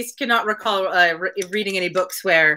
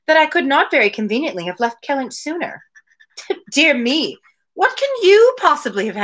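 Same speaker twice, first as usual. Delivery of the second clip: Mary's lines read a little higher, in a lightly voiced complaining tone; dialogue read aloud; 5 semitones higher than usual. Podcaster reading Mary's complaints to Anne, lightly voiced. Reads Anne's reply.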